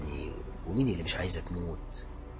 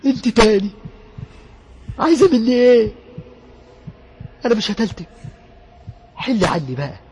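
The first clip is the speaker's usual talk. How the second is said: much higher and much louder, desperate pleading; terrified young man screaming; 10 semitones higher, 10 dB louder than usual. Acted. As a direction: scream at the ghost begging it to die. Possessed youth screaming at ghost. (شاب مرعوب بيصرخ في العفريت بصوت عالي جدًا)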